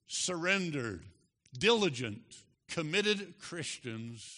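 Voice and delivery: usual speaking voice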